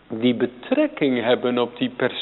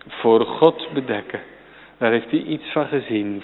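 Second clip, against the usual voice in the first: normal talking voice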